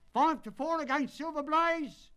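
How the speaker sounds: high, loud bookmaker's roar; roaring odds. Acted. Bookmakers at the racecourse roaring the odds to punters, loud and high. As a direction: bellow the odds over the crowd. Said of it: "roared the ring".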